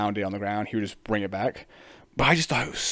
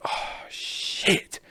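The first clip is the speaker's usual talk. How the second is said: scared whisper; 4 dB quieter than usual. Retold or imitated. Dude sees bird gang arrive.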